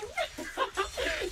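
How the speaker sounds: shrill gleeful cackle; high villain giggle. Acted. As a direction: giggle high with cruel delight.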